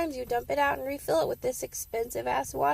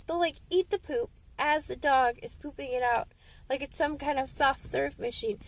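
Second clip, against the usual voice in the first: usual speaking voice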